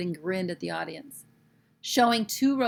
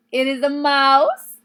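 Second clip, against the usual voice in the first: slow, high, louder creaky gloat; gleeful reveal; 7 semitones higher, 14 dB louder, slower than usual. Acted. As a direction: savour the payoff slowly. Triumphant reveal of mouse.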